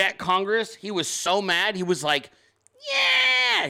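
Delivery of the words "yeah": high, drawn-out imitation scream; little mock scream. Telling how Phantom fumed at Congress, little mock scream. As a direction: squeal it out, mimicking an angry friend. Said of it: "he did a little scream thing".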